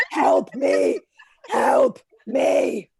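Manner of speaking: loud mock plea for help; drawn-out, hammed-up cry for help; stretch marks pleading. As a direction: call out as a desperate stretch mark. Voicing stretch marks spelling words.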